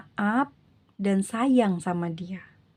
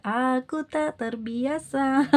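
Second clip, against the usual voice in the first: slow, higher-pitched imitation of the ex; slow voiced ex; 4 semitones higher, much slower than usual. The reader voicing the ex who misses the viewer, slow and higher. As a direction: drag out his lost lament.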